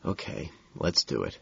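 very soft, low and defeated; quiet surrender after the outburst. A narrator's reading. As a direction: murmur it with eyes shut, drained. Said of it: "and then quietly he says".

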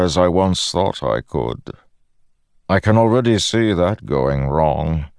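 lightly voiced menacing god; a hint of a smooth villain; Odium voice.

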